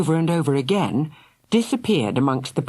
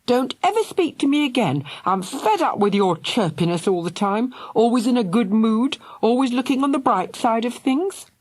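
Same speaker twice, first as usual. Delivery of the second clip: raised pitch, heated scolding; angry rant; 8 semitones higher than usual. A narrator's reading. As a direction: let the frustration pour out at her. Angry brother rants.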